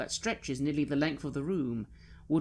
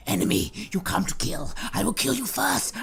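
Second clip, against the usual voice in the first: high, loud screech building higher; screeching creature; 12 semitones higher, 6 dB louder than usual. Acted. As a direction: screech threats like a frenzied bat creature. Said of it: "It is screeching at you".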